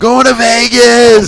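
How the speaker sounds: high, loud and stretched out; drawn-out hyped-up holler; excited shout. Acted. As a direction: whoop it out like you can't wait. Hyped about the Vegas trip.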